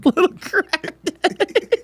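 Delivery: slow, high laughing echo; laughing repeat. Real, not acted. Friend echoing the phrase, laughing. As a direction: repeat it cracking up.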